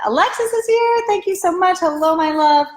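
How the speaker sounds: high delighted hello, easing down; excited greeting. Real, not acted. Host spots Alexis joining and thanks them, excited greeting. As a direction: break off to hail a friend brightly.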